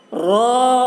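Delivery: را said full and heavy, drawn out; thick letter sound. Shown as a demonstration. Teacher demonstrates a heavy را for students. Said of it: "دیکھیے را".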